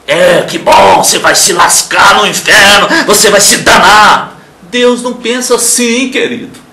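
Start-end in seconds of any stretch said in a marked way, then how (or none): 0.1-4.4 loud, sneering mock of a cruel God; a hammed-up vengeful deity taunting sinners; mock gloating. Mocking the harsh God people imagine.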